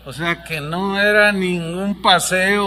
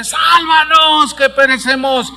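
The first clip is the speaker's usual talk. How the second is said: grito lento, agudo y fuerte; grito de auxilio; 7 semitones higher, 6 dB louder, slower than usual. Acted. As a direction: clama por salvación. Discípulos gritando en tormenta. (discípulos claman a Jesús en la tormenta)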